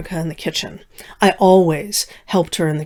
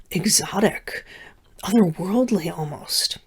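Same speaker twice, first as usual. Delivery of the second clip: hushed and softer, wondering; whisper; 7 dB quieter than usual. Acted. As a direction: lean in and whisper the mystery. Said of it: "unvoiced whisper".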